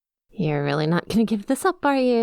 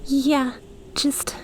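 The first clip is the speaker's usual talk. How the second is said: ordinary talk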